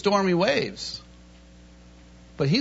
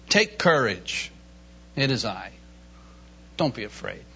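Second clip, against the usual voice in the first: reassuring; 10 semitones lower, 5 dB quieter than usual. Acted. Jesus calms frightened disciples.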